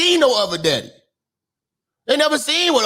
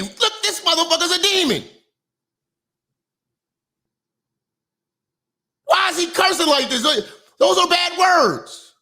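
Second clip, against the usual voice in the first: higher-pitched mock voice of scared kids; 5 semitones higher than usual. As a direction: imitate frightened summer-camp kids, pitched up a little. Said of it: "they were scared of me".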